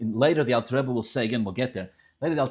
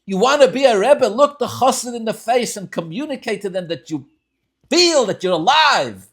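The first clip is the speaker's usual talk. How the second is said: higher, louder, slowed pleading; a student begging his teacher to connect; the young Rebbe Rashab demanding real presence from his brother; impassioned plea; 7 semitones higher, 7 dB louder than usual. Retold or imitated. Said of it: "said the Rebbe Rashab".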